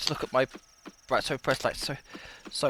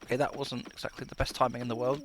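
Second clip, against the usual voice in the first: ordinary delivery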